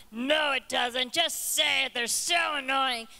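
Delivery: loud, slow tempting devil in your ear. Acted. Preacher voicing the devil urging her to snap. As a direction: egg them on like a sneering devil. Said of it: "you got the devil on the other side".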